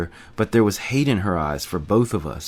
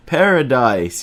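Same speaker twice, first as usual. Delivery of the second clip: louder, higher joyful yell; screamed name; 4 semitones higher, 5 dB louder, slower than usual. A narrator's reading. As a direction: shout his name across the canyon. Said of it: "he screamed".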